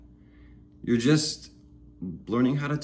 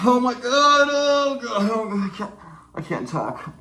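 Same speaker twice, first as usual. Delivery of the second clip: mock panic; 11 semitones higher than usual. The speaker acting his own panic at stuttering, high and shrill. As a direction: shriek in hammed-up panic. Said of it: "me learning how to speak".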